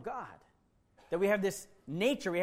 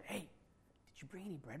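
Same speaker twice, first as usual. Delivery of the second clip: whispering; 17 dB quieter than usual. Acted. Disciples whispering in the boat.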